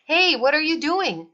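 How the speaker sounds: high, loud call across the water; alarmed shout. A narrator's reading. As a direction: yell after the fleeing baby. Boom shouting at the mer baby.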